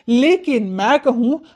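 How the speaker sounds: ordinary delivery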